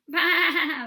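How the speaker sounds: loud, high bleat; sheep bleat. Shown as a demonstration. Practising the bleating sound with the children, loud bleat. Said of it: "Like this".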